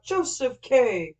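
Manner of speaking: drawn-out shout of the name; slow loud call. Acted. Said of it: "slowly called out".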